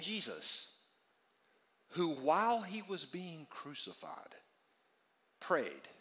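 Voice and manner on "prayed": ordinary talk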